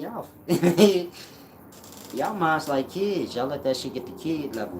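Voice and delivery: mock them while laughing; laughing mockery. Real, not acted. Mocking viewers, loud and laughing.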